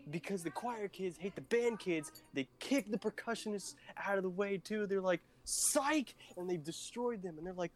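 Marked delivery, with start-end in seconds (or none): 5.4-6.1 mocking psych. Choir kids mocking the band.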